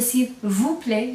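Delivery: "s'il vous plaît" said slowly so the vous is heard; slow demo. Shown as a demonstration. Teacher to class, slowly showing the vous. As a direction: stretch it slowly, landing on vous. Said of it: "you're gonna hear that vous again".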